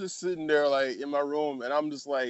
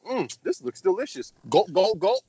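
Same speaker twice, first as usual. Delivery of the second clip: put-on higher childlike "Mm, this looks delicious", then gulping noises; little boy voice; 14 semitones higher than usual. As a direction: play a kid about to drink Pine-Sol. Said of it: "The little boy said".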